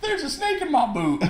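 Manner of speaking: high squeaky toy cowboy; cartoon cowboy voice. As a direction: squeal it like a pull-string cowboy. Jokey Woody Toy Story quote.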